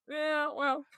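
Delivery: high giggling through words; laughing. Real, not acted. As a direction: laugh it out helplessly. Laughing at cat in face.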